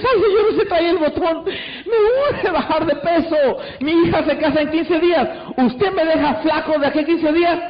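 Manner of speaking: high, whiny, tearful begging; hammed-up desperate customer near tears; pleading client. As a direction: plead like your life depends on it. Desperate client begs to lose weight.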